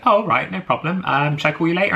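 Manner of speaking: high, chirpy no-problem tone; casual date. Retold or imitated. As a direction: lightly mimic his breezy reply. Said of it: "And he just goes like".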